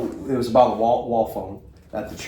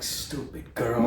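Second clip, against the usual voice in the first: imitated grumble, much softer; muttered insult; 10 dB quieter, much slower than usual. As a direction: mutter it under your breath as the accented man. Man grumbles after being hung up on.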